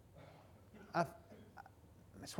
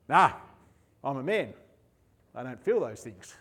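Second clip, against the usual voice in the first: a lower, gruff real-man voice; mock tough guy; 8 semitones lower than usual. Preacher mocking a macho man who denies feelings. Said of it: "we were told that a real man doesn't have emotions".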